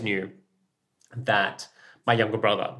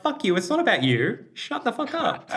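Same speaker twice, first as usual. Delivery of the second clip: much higher, snapping back; mock angry retort; 12 semitones higher than usual. Snapping at people who say they knew, much higher. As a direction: tell them off hard.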